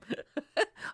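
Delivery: high laugh. Pretend. Pleading with the angry boyfriend.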